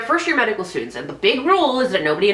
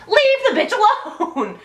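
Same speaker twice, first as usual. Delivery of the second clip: mock exasperated woman; 11 semitones higher, slower than usual. Mocking Corinne's rejection.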